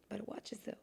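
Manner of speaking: unvoiced, threatening whisper; menacing whisper. Imitating a stern elder warning a kid, whispered. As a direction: warn them under your breath.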